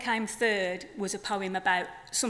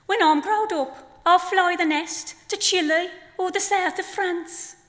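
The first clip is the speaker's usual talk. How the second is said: higher, louder chirpy chick voice; baby flamingo; 8 semitones higher, 5 dB louder than usual. Acted. Baby flamingo pestering his nan, chirpy and high. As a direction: pipe up like an eager little chick. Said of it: "in the voice of two flamingos".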